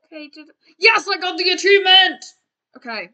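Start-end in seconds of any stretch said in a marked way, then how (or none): 0.8-2.2 loud, high-pitched cheer; triumphant shout. Real, not acted. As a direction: yell it out in triumph. Celebrates getting an achievement.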